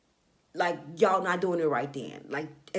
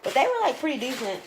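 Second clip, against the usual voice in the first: much higher, playful backpedal; high-pitched hedge; 7 semitones higher, 4 dB louder than usual. Real, not acted. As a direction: squeak out the downgrade. Correcting the hype.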